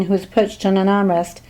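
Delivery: ordinary delivery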